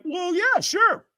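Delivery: high, loud shouted yes; mock excited agreement; eager yes. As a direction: jump at the offer. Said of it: "And I'm like".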